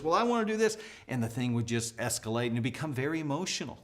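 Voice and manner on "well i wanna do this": whiny daughter. Retold or imitated. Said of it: "And she would go".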